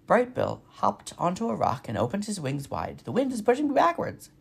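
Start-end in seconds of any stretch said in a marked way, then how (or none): 3.0-4.3 squeaky gosling voice. A narrator's reading. Gosling struggling in wind.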